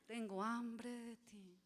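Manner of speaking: soft, slow, hushed longing; tender longing. Pretend. Preacher voicing a believer longing for God, soft and slow. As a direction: breathe it out softly and slowly to God. Same phrase turned to longing.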